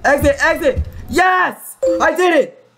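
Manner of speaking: high victorious shout; triumphant. Real, not acted. Escapes, wins.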